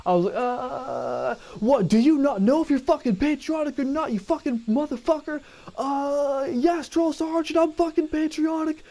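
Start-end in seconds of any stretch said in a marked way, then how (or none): 0.3-5.4 drill sergeant. Retold or imitated. Drill sergeant yelling at recruit. 5.8-8.8 barked military recruit reply. Retold or imitated. Recruit answering drill sergeant.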